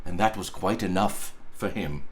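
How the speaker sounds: ordinary delivery, nothing marked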